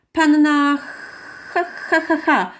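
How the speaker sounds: clown's comic name with drawn-out ha-ha-ha; high, stretched mock-laugh syllables; clown calling out. A narrator's reading. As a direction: present a silly name as a slow ha-ha-ha. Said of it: "zawołał".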